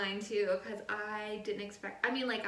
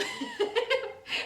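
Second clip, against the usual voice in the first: laugh; 7 dB louder than usual. Real, not acted. Laughs at friend's remark.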